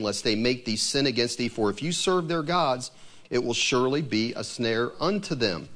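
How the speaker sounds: measured, solemn reading of scripture; steady, reverent Bible reading. Acted. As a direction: read it like holy writ, deliberate. Reads scripture passage aloud.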